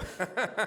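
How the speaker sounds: a quick embarrassed laugh; short laugh. Real, not acted. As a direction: laugh it off briefly. Agreeing with the wrong item.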